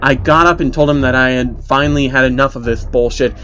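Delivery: plain reading voice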